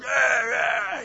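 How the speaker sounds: high, loud cheering yeah; fan shout. Retold or imitated. Preacher imitating fans at a game, cheering shout. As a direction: yell it like a crowd.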